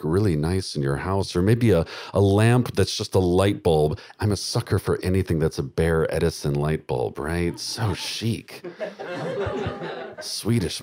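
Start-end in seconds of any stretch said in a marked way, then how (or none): none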